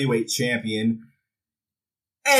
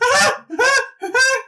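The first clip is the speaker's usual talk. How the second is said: imitated gleeful cackle; 4 dB louder than usual. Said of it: "David Flair just like".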